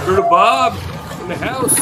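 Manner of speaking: loud hype-man call; excited shout. Real, not acted. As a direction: shout it like an announcer. Streamer hyping call sign.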